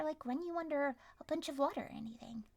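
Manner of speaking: ordinary talk, nothing marked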